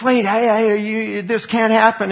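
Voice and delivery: high, desperate protest of a damned man; panicked plea. Acted. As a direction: plead in high-pitched panic. Damned man pleads in hell.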